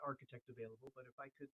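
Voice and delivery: muffled and far off, like from a closet; faint voice coming through a closed door. Real, not acted. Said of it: "Now you sound like you're in the closet".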